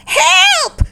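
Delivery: very high, loud cry of help; screaming for help. A narrator's reading. Shay thinks a monster is after him, screaming. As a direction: scream as if chased. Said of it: "screamed Shay".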